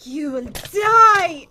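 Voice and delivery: loud, drawn-out mock threat. Player playfully threatens the game, loud mock threat. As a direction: stretch out the doom, loud on the last word.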